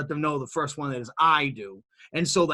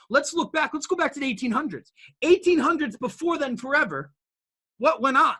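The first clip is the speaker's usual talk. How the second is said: rally the room like a preacher; preaching build; 8 semitones higher than usual. Real, not acted. Speaker rouses listeners about human entrepreneurship, building preaching. Rhetorical history sweep.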